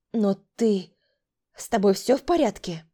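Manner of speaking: ordinary delivery, nothing marked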